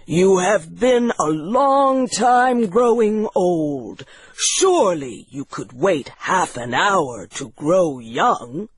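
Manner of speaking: lightly voiced cool doctor; Doctor Heidegger. Doctor to guests impatient to grow young, cool understated voice. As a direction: coolly reason with them, in a slight character voice. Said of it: "said Doctor Heidegger, who watched the experiment with scientific coolness".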